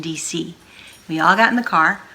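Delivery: ordinary delivery, nothing marked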